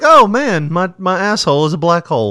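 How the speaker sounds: loud, high-pitched cry of mock dismay; mock astonishment. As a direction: act out a stunned discovery, starting high and loud. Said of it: "You're like".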